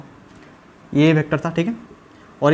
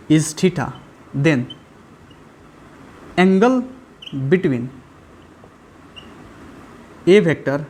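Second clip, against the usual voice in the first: word by word, long pauses between; slow, measured board dictation; dictating while writing; much slower than usual. Acted. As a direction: say it out as you write each word.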